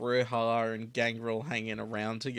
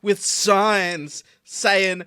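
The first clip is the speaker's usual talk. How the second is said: high, loud, slow rally chant; loud protest shout; 8 semitones higher, 10 dB louder, much slower than usual. Acted. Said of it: "protest chant".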